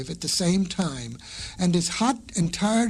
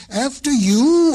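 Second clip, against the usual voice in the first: higher, louder after you; urgent insist; 7 semitones higher, 8 dB louder than usual. A narrator's reading.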